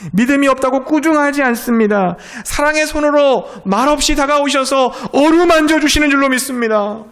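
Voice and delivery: high, loud fervent sermon peak; loud, soaring preacher voice; climactic preaching. Real, not acted. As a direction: lift the congregation with passion.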